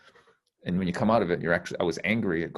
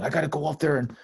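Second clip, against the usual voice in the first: higher, pushy mock ego; lightly voiced nagging inner ego; ego's voice; 8 semitones higher, 4 dB louder than usual. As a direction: voice the anxious ego urging you on. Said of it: "it's my ego going".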